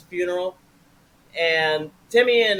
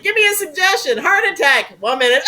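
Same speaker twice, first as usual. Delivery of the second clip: high, loud mock-frantic performer; hammed-up cry to the audience while keeling over; improviser dying onstage; 11 semitones higher, 6 dB louder than usual. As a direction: play a comic onstage death, pitched up and loud. Acts out improviser dying on stage.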